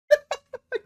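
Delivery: squeaky high laugh breaking out; high burst of laughter. Real, not acted. After his mock wailing about lost items, a high laugh.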